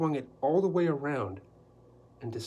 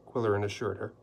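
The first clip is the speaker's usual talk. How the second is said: plain reading voice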